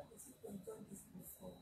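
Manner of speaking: almost silent, voiceless; muttered under breath. Real, not acted. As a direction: mumble it to yourself.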